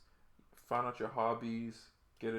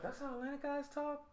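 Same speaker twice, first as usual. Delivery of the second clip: higher, lightly mocking girl voice; imitating curious girls; 5 dB quieter than usual. Mimicking girls who ask about his Atlanta way of talking, in a higher mock voice. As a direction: parody a puzzled girl asking. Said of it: "And they be like".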